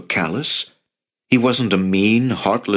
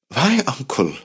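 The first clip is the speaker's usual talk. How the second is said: put-on higher-pitched troubled boy's question; anxious questioning; 6 semitones higher than usual. A narrator's reading. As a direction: plead for an explanation in a raised pitch. Frightened nephew questions his uncle.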